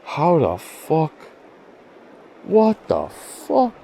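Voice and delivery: very high, slow, stretched-out bafflement; incredulous. Real, not acted. Angler staring at inexplicable mess, incredulous. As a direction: draw each word out high in disbelief.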